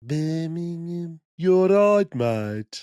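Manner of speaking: slow, hammed-up Brummie; Birmingham accent. Host mimicking the Birmingham locals, mock Brummie accent. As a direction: put on a broad Birmingham accent. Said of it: "I'm close to Birmingham".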